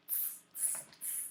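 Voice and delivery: whispered monkey hiss sounds; soft unvoiced tss tss tss. A narrator's reading.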